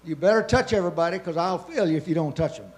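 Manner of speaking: ordinary delivery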